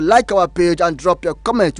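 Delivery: usual speaking voice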